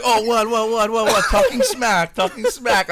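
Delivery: high, loud accusing voice; indignant customer. Retold or imitated. The customer who overheard confronts him, high and loud. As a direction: call him out for talking smack. Customer catches salesman bragging.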